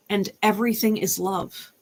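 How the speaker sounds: ordinary delivery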